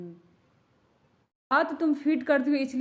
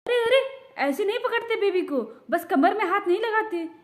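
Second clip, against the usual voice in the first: higher, louder urgent "arey arey"; alarmed warning; 7 semitones higher, 3 dB louder than usual. Acted. Elder stopping someone holding the baby wrongly, alarmed. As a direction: stop them before they drop the baby.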